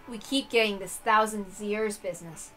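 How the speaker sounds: ordinary delivery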